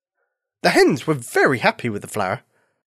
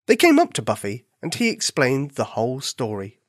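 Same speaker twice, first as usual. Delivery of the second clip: plain reading voice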